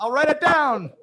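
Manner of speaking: high, loud punchline holler; screamed reply. Acted. Italian in the boat answering the Irishman, screamed. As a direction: shout the punchline across the water. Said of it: "he screamed over to the shoreline".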